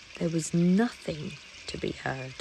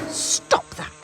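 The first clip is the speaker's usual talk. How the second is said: quacked; 4 dB louder than usual. Acted. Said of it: "he quacked".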